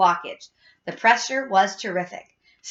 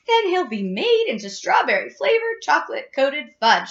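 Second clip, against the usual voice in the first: high, wailing protest; horrified scream; 6 semitones higher, 3 dB louder than usual. A narrator's reading. Missus Gloop pictures her son as fudge, screaming. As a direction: wail each word in horror. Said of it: "screamed Missus Gloop".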